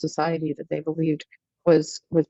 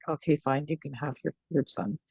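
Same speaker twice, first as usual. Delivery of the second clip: softer, giving in to the mother; softened, giving in; 4 semitones lower, 7 dB quieter than usual. Retold or imitated. British commander gives in to a pleading mother, softer. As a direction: let the commander relent, quieter, with a sigh of defeat. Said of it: "he softened and he's like".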